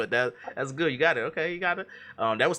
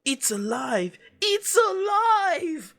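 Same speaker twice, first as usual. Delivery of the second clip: 1 mad scientist; 8 dB louder, much slower than usual. Acted. Quoting Frankenstein in quiz.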